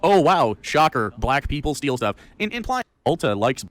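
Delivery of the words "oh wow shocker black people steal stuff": louder sarcastic "wow, shocker"; louder dripping-sarcasm voice; sarcastic mock shock. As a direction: sneer it like a fake surprise. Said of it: "it's literally just saying".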